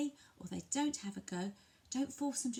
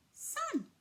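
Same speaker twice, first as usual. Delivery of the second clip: bright high single word; naming for a child. Shown as a demonstration. Parent naming the sun for a toddler, high and bright. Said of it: "point to it and say".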